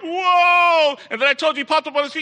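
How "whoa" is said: high, loud whoa of alarm; audience gasp. Retold or imitated. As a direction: echo the crowd's shock, high and loud. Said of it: "and you're all like".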